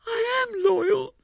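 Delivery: high, slow, loud protest in a woman's voice; wife crying out. A narrator's reading. Wife protesting to her accusing husband, high wailing protest. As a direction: wail it out, stung. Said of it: "she cried".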